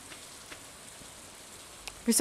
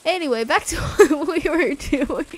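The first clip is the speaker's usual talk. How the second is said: loud, higher, breaking into laughter; laughing; 4 semitones higher, 9 dB louder than usual. Real, not acted. Getting back to the game, laughing. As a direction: try to move on through laughter.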